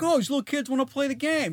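high, kindly stranger voice; friendly stranger voice. Retold or imitated.

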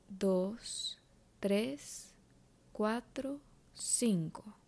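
soft, slow counting of breaths. Shown as a demonstration. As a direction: count each breath quietly and slowly. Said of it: "te voy a guiar con unas cuentas".

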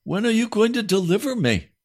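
high, impassioned cry to God; pleading prayer. Acted. Preacher crying out to the Lord in prayer. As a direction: beg the Lord for deliverance.

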